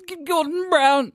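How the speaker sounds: loud, high, stretched-out name; hammed-up indignant cry of "Gordon Brown"; mock outrage. Shown as a demonstration. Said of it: "have to say it like that".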